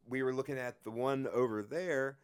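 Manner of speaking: ordinary delivery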